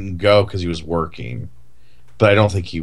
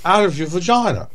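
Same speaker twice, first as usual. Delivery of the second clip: higher, hammed-up Cockney doctor; mock Michael Caine doctor; 7 semitones higher than usual. Host mocks the Cider House Rules doctor, higher pitched. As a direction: crude parody of a film doctor. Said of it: "I'm Michael Caine, the abortionist".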